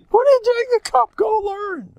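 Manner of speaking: very high, fast wifely scolding; imitated scolding wife. Wife sending her husband to go learn, mock scolding. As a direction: imitate a wife shooing him off, high-pitched.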